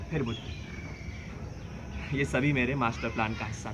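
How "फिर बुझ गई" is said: slow unvoiced whisper. Narrator whispering as the passage light goes out. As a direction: breathe it out as the light dies.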